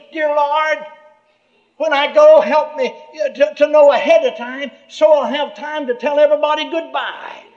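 a man praying ahead of his death; earnest prayer. Retold or imitated. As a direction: plead with the Lord. Retold prayer.

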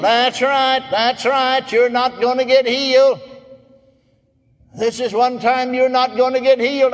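high, insistent devil on one pitch; devil's taunt. Acted. Devil tells sick man he won't heal, insistent taunt. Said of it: "I know it's the devil".

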